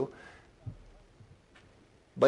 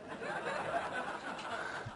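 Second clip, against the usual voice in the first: high breathy laugh; 12 dB quieter than usual. Real, not acted. Laughs at own joke.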